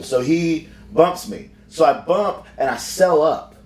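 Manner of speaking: ordinary talk, nothing marked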